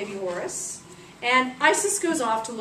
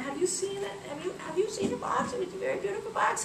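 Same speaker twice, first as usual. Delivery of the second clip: put-on high, softer old woman's voice; old beggar lady; 9 semitones higher, 4 dB quieter than usual. Disguised Isis asking everybody in town, old beggar lady. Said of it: "disguised as an old beggar lady".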